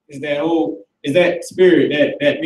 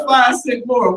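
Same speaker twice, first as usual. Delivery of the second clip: mock Chinese shopkeeper; 7 semitones higher, slower than usual. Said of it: "You like".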